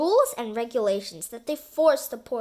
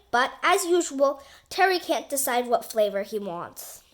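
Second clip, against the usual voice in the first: plain reading voice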